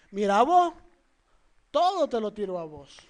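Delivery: lightly voiced resentful churchgoer; a churchgoer grumbling that the sermon is aimed at him; mock offended listener. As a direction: toss it off like a sulky accuser. Mocking a church member's reaction.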